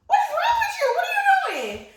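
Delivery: very high, loud mock-outraged yell; hammed-up high-pitched road-rage shout; retold yelling. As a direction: screech it like an exasperated driver. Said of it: "while I'm yelling at this dude".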